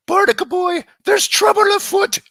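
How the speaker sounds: high, loud cartoon hero alarm; hammed-up superhero cry; cartoon impression. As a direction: call your sidekick to danger. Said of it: "The other one I like to do".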